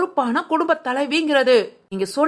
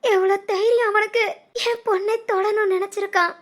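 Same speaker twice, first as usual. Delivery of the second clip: distraught, weeping appeal; tearful pleading; 2 semitones higher than usual. Acted. Mother begging relatives to save her daughter's wedding, weeping. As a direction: plead between sobbing gasps.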